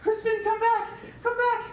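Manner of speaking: very high, loud panicked shout; desperate yell. Acted. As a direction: scream for the dog to return. Desperate yelling for dog.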